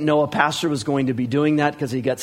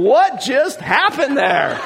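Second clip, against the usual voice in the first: slow, high, drawn-out bewilderment; amazed disbelief; 8 semitones higher, 4 dB louder, much slower than usual. Retold or imitated. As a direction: retell it stunned, stretching each word through laughter. Driving home, bewildered after encounter.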